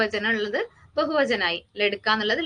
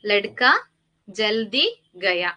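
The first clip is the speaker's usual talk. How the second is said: every word stretched out very slowly; very slow example; much slower than usual. Shown as a demonstration. Teacher to students, reading the example very slowly. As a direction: dictate the Hindi sentence word by word. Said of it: "ജൽദി എന്ന് പറഞ്ഞിട്ടുണ്ടെങ്കിൽ എന്താ?".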